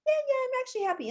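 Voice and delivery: high, fast fake-happy voice; fake cheerful. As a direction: chirp it out like a forced smile. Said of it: "You can't pretend like".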